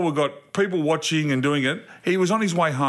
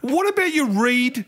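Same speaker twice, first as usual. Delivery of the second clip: exasperated sarcasm; 6 semitones higher than usual. Retold or imitated. Dad to son who guessed by colour, exasperated sarcasm. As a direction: spell out the obvious, acting the fed-up dad. Retold row with son over fuel.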